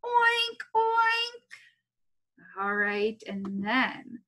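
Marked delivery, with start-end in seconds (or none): 0.0-2.6 high piggy oink, played up for kids; high squealy oink. Shown as a demonstration. Teacher showing first graders a pig sound, high squeal. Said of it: "make the sound that a pig makes".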